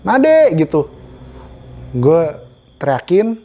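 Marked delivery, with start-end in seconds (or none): 0.0-0.6 high pitched call of each friend's name. Retold or imitated. Lost hiker calling friends one by one, high shouted names. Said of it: "gue teriak lagi".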